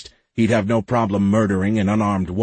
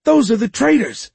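high, loud accusing yell; shrieked accusation, pitched way up; 5 dB louder than usual. A narrator's reading. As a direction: denounce them to the whole crowd. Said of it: "yelled one of the lower deckers".

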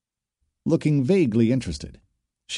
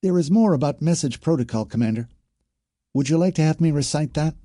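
trooper's high but less drilled voice; polite, pitched-up trooper's question; twang dropped; 7 semitones higher, faster than usual. A narrator's reading. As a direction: offer more to a superior without the lecturing twang. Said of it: "dropped the training officer twang".

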